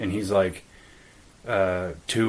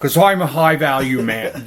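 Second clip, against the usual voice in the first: louder, higher hammed-up alpha-male swagger; mock Tate; 5 semitones higher, 9 dB louder than usual. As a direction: puff up like a smug self-proclaimed high-value man. Mocking Tate's attitude.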